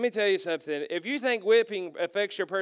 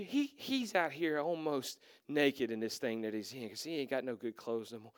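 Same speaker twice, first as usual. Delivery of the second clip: ordinary talk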